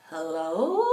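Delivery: drawn-out, uncertain hello, lifting at the end; wonky hello. Retold or imitated. Shaken woman answering her friend's call, wonky hello. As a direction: answer the phone slightly off. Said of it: "something wonky in my voice".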